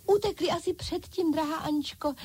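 high-pitched doll chorus; dolls crying out. A narrator's reading. Dolls agree with their dear leader, high-pitched exclaiming. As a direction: chime in high, as excited dolls. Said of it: "vykřikly panenky".

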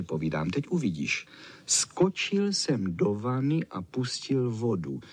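plain reading voice

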